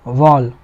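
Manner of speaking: वॉल with a low, rounded "aw"; heavy "o"-coloured vowel in वॉल. Shown as a demonstration. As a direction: drop the pitch on वॉल, say "wawl". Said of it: "ओ वाला sound लेना है।".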